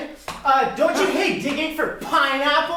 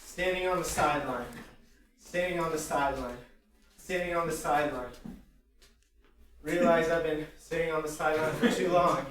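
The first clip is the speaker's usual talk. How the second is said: soft, low deadpan thoughts; inner monologue; 8 semitones lower, 9 dB quieter, faster than usual. Acted. Nervous improviser thinking on the sideline, hushed inner monologue. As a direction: think aloud, low and quiet.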